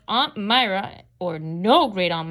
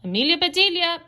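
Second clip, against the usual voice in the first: lightly called name, pitched up; high-pitched summons, only mildly raised in volume; calling out; 8 semitones higher, faster than usual. A narrator's reading. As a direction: call the maid with a high lift. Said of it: "Missus Rogers called".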